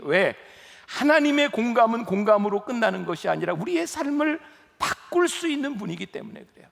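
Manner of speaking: ordinary talk, nothing marked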